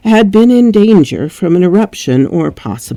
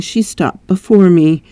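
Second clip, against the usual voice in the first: ordinary delivery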